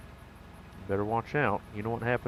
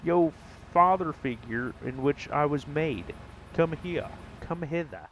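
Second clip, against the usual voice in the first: mock bro greeting; 6 semitones higher than usual. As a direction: hail dad like a jokey bro. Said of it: "I say".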